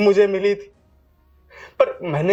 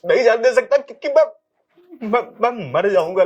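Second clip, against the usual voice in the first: higher and louder refusal; desperate cry; 4 semitones higher, 4 dB louder than usual. Acted. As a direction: cry out that you won't let her go. Refuses to let wife go. (ऊँची आवाज़ में बेताब इनकार)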